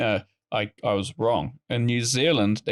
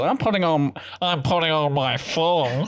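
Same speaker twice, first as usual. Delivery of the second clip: put-on Australian accent; 5 semitones higher than usual. Australian announcing the thong is going on, mock Australian accent. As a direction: put on a broad Australian twang. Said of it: "So when Australian goes".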